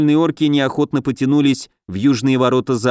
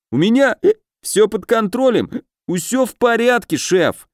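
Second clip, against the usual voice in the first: much higher, comic character voice; eager subordinate voice; 10 semitones higher than usual. A narrator's reading. Underling assures the chief all is fine, high-pitched. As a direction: pipe up high with hesitant assurance.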